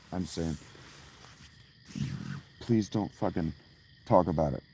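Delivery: ordinary talk, nothing marked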